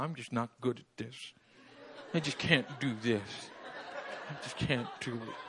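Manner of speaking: lightly hammed-up despair; mock defeated. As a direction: mimic someone giving up. Voicing defeated self-talk.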